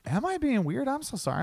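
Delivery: high-pitched retold apology; acted apologetic squeak; mock apology. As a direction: raise the voice high into an over-sweet sorry.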